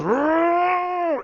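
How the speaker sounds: long, loud, high roar; Hulk roar. Acted. Hulk roars as he kills him, long loud roar. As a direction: roar full out like a monster.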